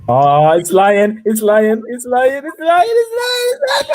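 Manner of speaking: escalating frightened shouts; panicked rising cries. Real, not acted. Being chased in the game.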